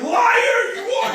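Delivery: very high, loud mock yell breaking into laughter; mock accusing shout. Teacher acting out the wrong response to a liar, mock shouting. Acted accusation, joking example.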